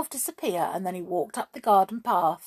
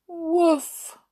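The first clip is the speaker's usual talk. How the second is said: high, drawn-out little woof; sad dog woof; 8 semitones higher, 4 dB louder than usual. A narrator's reading. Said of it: "said Jinky sadly".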